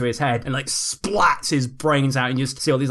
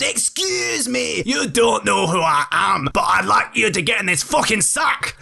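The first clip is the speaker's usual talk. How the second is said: menacing villain; 6 semitones higher than usual. Acted. Villain demands twins get in sack.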